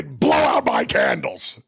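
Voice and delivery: deep, slow shouted growl; Vince McMahon. Acted. Boss ordering candles blown out, growled impression. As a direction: order it in a drawn-out low bellow. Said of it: "That's Vince".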